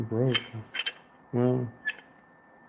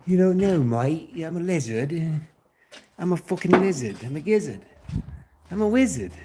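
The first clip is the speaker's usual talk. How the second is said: louder, higher silly put-on accent; exaggerated mock accent with punched, swooping words; Geico gecko's brother; 5 semitones higher, 6 dB louder than usual. Riffing nonsense about lizards and wizards in a loud weird accent.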